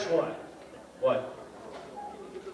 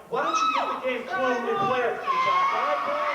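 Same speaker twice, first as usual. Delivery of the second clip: loud, higher, exasperated telling-off; exasperated shout; 8 semitones higher, 4 dB louder than usual. Real, not acted. Host shouts at Mike in front of the audience, exasperated. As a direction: shout him down in front of the crowd. Heated onstage argument.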